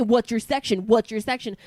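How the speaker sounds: mock nagging repeated question; insistent questioning. Guy kept asking for the section, imitated insistently. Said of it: "so he kept asking me".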